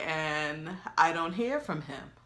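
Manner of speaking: ordinary delivery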